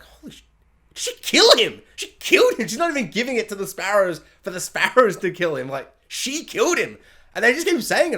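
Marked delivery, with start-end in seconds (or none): 0.0-7.0 higher, louder disbelief; retold shock. A viewer realising she killed him, shocked and louder. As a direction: gasp out the realisation. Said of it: "I'm like".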